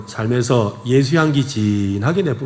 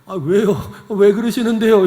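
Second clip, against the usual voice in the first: high-pitched, taken-aback question; mock flustered questioning, pitched up; startled, retold; 7 semitones higher than usual. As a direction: act out being caught off guard. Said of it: "갑자기 놀래가지고 이러고 있으니까".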